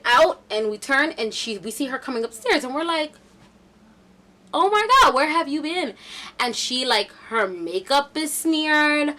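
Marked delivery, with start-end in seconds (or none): 4.5-5.9 high, loud exclamation; acted astonishment. Friends finding missing Brooklyn on the stairs, high and astonished. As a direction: burst out in mock shock at seeing her.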